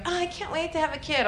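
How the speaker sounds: mock an eager mom-to-be; a light imitation of a gushy girl; gushing girl. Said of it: "those girls that was like".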